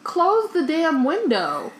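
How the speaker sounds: high, slow mock scolding; exasperated. Real, not acted. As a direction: scold her like a fed-up friend. Mock scolding her co-host.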